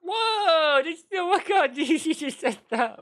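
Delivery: loud, high whoop of disbelief; real scandalised outburst; shocked exclamation. Real, not acted. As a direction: burst out in shock, breaking into laughter. Shocked at what was said.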